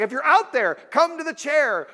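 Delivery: loud, high call across the park. Retold or imitated. Magician calling to the invisible man, loud. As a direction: shout it out to someone unseen. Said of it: "He's like".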